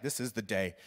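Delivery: low, soft, half-hearted agreement; meek repeat under pressure; reluctant echo. Retold or imitated. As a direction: agree quietly, without conviction. Meek echo of roommate's order.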